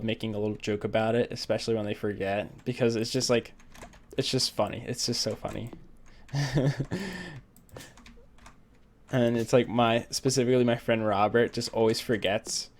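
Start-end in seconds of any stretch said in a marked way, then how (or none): 6.3-7.4 wordless laugh to oneself; amused laugh. Real, not acted. Laughing over friends forgetting, amused laugh. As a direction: laugh at your own story.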